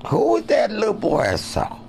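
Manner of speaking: high, loud motherly question; mock mother voice. Mother asks about the little boy, high pitched. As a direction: pipe up like a curious mom.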